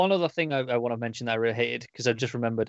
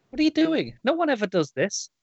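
much higher and louder, incredulous outburst; exasperated disbelief; 13 semitones higher, 5 dB louder than usual. Retold or imitated. Reader baffled by the comic caption, loud and incredulous. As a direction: throw your hands up at the page. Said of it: "I'm like".

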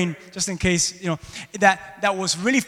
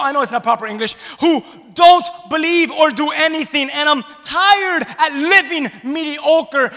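1 weary shout; 10 semitones higher, 9 dB louder than usual. Acted. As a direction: shout out your tiredness. Preacher voicing weary Peter, shouting.

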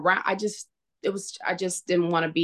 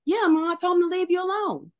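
imitating her son in a much higher voice; retold teen reply, pitched way up; son's voice; 10 semitones higher than usual. Son answers mom about the text he sent, higher son's voice.

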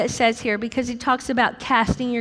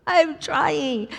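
mock whine; 4 semitones higher, much slower than usual. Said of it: "usually is my answer".

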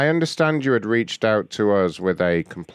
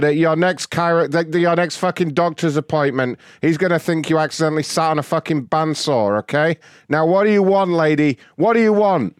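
loud furious rant; swearing tirade; 5 semitones higher, 3 dB louder than usual. Real, not acted. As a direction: yell abuse down the phone. Raging at prank caller.

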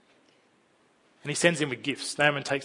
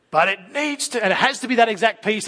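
put-on higher, whiny child insisting; insistent child; 7 semitones higher, 5 dB louder than usual. Child insisting to the parent that the piece must fit, higher and whiny. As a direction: demand it like a stubborn kid.

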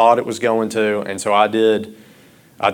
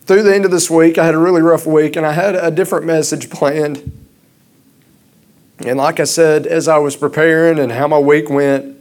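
louder, higher, urgent sermon voice; impassioned preaching; 7 semitones higher, 4 dB louder than usual. Acted. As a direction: preach it hard to the congregation. Continues sermon, raised voice.

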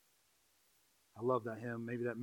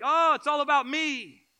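higher, slow, whiny "oh, it's all about me"; hammed-up pity-me sigh; mock self-pity; 13 semitones higher, 12 dB louder than usual. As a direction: sulk it out theatrically.

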